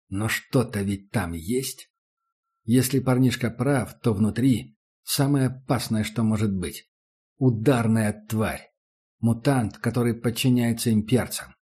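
ordinary delivery

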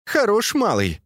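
high-pitched scolding exclamation; exclaiming. A narrator's reading. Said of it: "воскликнула Фэнзе".

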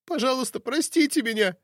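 high, weeping plea for forgiveness; tearful pleading. Acted. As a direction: beg through tears. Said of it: "по щекам немедленно побежали слёзы". (плачущая мольба)

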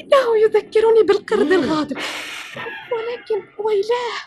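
high voice rising into a frightened cry; growing fear. Acted. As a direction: let the fear build to a shriek. Sister describes angry monkey. (خوف متصاعد بصوت عالٍ)

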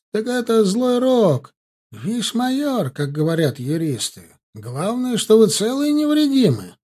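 put-on an elderly professor bursting with relief; excited comfort. A narrator's reading. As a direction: cheer him up with gusto. Professor consoles hero excitedly.

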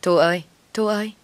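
mild narrated call for someone; searching call, not a full shout. A narrator's reading. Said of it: "Chồng tôi gọi to".

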